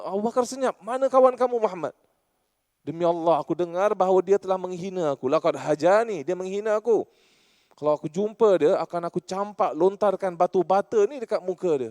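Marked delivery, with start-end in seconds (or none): 0.8-1.9 high, loud furious demand; shouting demand. Acted. Angry wife shouting at Abu Bakar for Muhammad, high and loud. 2.9-11.9 mock angry threat. Ummu Jamil threatening.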